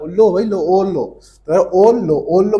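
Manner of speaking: loud eager urging; insistent offering. Pretend. Host pressing guest to eat. (ज़ोर से आग्रह करते हुए)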